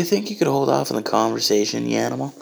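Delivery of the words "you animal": ordinary talk, nothing marked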